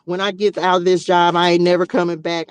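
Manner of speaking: loud and emphatic, swearing off the job; loud vow. Real, not acted. Nurse telling viewers she is quitting, loud and emphatic. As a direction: declare it loud, done for good. Vows to quit her job.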